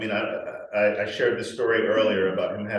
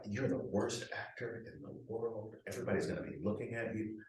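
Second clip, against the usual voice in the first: anxious inner voice; 2 semitones lower, 15 dB quieter than usual. Retold or imitated.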